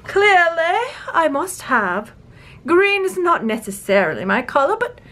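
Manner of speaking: hammed-up royal English accent, swooping up; imperious mock monarch; imitated queen. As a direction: play a fussy queen finding her accent. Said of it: "I'm losing the accent".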